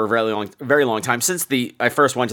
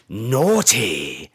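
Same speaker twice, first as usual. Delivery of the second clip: low, drawn-out British scolding; British accent; 4 semitones lower than usual. Retold or imitated. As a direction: imitate a British narrator savouring the word. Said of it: "some random British guy".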